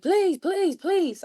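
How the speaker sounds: high-pitched repeated begging; retold plea, each word pitched high; pleading. Girl begging a car service to take her, pleading.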